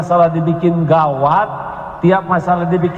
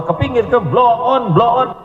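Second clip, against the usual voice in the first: mock TV yelling; 6 semitones higher than usual. Yelling at the TV during football.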